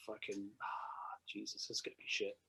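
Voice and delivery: slow, hushed gloomy whisper; whispered dread. Retold or imitated. Fan fearing the band won't play, whispered dread. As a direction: breathe it out like dreading the worst.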